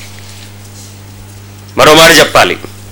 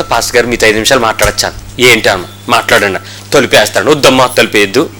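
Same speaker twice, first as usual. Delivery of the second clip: hushed private request; 9 semitones lower, 5 dB quieter than usual. Retold or imitated. Woman asks pastor privately.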